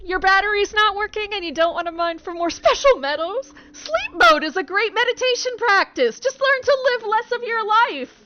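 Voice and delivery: high, loud put-on robot voice; robot Thecla voice. Robot Thecla lecturing Jeremy, high and loud.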